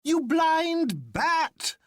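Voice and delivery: high, very slow, gleeful scold; high jolly doctor teasing his friend; Bull crying out. A narrator's reading. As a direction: drag out the insult, high and amused. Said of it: "cried Bull".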